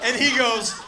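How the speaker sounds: ordinary delivery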